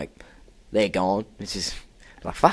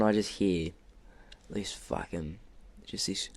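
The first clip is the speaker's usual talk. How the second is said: ordinary delivery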